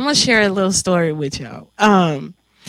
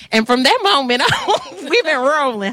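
laughing; 6 semitones higher than usual. Real, not acted. Happy memory of friendship.

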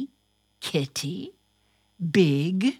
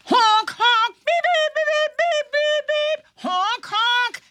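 high, loud honking and beeping; car horn noises; 4 dB louder than usual. Acted. Host making car horn sounds for the story, high and loud. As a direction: toot like city traffic.

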